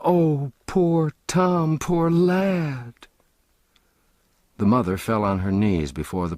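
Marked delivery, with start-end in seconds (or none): 0.1-2.9 high, slow, grieving cry; woeful cry. A narrator's reading. Sisters cry out in dismay over poor Tom. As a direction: mourn him aloud. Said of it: "with woe and dismay".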